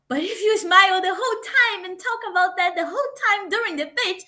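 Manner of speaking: high, fast, grinning voice that never lets up; mock over-cheerful pitch voice; nonstop forced smile. Shown as a demonstration. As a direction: smile through every word till it grates. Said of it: "it's gonna be annoying".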